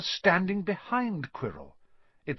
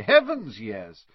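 hammed-up surprise; mock exclamation; 6 dB louder, slower than usual. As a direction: Quirrell exclaiming at Harry's ignorance.

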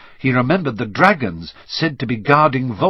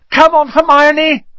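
high, loud holler; calling out; 9 dB louder than usual. Acted. Boy calls friend down through the trapdoor, loud high shout. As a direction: yell up for a friend to hurry.

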